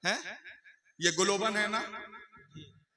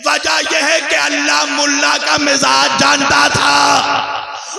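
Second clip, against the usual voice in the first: loud, high sermon climax; full-force preaching; 8 semitones higher, 15 dB louder than usual. Real, not acted. Preacher to congregation on the purity of Ahle Bait, full-force shout. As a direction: thunder it out to the congregation.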